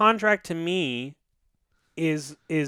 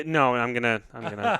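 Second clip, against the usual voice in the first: usual speaking voice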